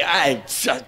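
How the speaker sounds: ordinary talk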